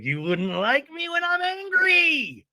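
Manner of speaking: high, slow mock-menacing Hulk line; Hulk quote. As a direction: ham up the Hulk warning. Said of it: "Bruce Banner turns into the Hulk".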